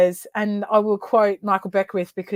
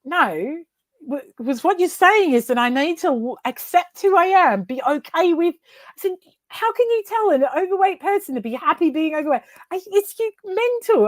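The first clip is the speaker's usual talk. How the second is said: high-pitched indignant protest; 9 semitones higher than usual. Retold or imitated. Retold heated argument with mentor.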